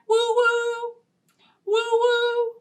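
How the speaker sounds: high hooting train whistle woo-woo; playful whistle call. Pretend. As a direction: hoot like a toy train.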